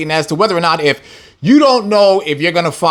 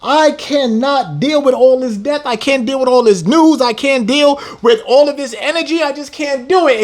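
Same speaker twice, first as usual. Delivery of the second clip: whiny complainer; 6 semitones higher than usual. Retold or imitated. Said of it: "lackadaisical people that was like".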